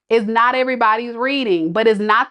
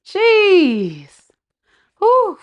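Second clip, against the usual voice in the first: exasperated jeez; 9 semitones higher, 4 dB louder than usual. Real, not acted. Exasperated exhale.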